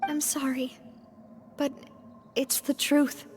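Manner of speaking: hushed, breathless apology; sorrowful whisper. Acted. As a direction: whisper it, dreading the news. Breaking tragic news.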